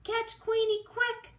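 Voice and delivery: very high, loud cry for help; urgent call. Acted. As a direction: yell it urgently like a duck. Duck calls for help.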